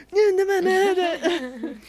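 high, loud garbled squeal; mock panicked wail. Reader retells her reaction to the book, mock wailing. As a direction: squeal it like an exaggerated freak-out.

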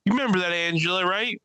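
high-pitched, needling call-out; mock female comic. Woman comic calling out her ex in the audience, high mock voice. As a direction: imitate her calling out an ex from stage. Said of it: "So she's like".

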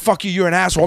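ordinary delivery

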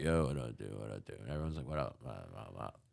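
low, soft imitation of mellow bros; chill dude greetings. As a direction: toss out low, lazy bro hellos. Imitating the dudes greeting him.

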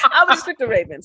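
laughing through the words. Real, not acted. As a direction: say it while cracking up. Repeats pick while laughing.

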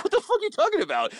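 high, fast mock disbelief; squeaky mock outrage at a silly question; incredulous retort. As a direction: throw it back like the question is absurd.

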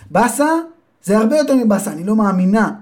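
high, indignant mother's voice. Acted. Mother rejects her son's word and curses the thief. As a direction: scold like an outraged mother.

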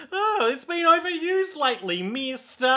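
very high, put-on little creature voice. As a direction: squeak it like a tiny helper making excuses. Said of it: "all the Sex Pistols are like".

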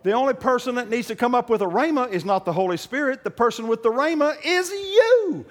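high, loud preaching building to a shout; preaching at full force. Acted. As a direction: drive it home to the congregation. Preaching climax.

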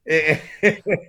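high, loud laugh; burst of laughter. Real, not acted. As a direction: laugh out at the punchline. Laughing at the retold joke.

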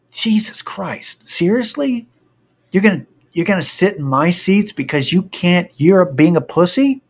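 retold indignant challenge; exasperated disbelief. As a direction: question them as if you can't believe it. Retold scolding of timid kid.

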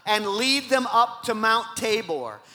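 high, loud sermon delivery; full-force preaching. Acted. Preacher rousing the church with Barak's story, full-force preaching. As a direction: preach it big, stretching the key words.

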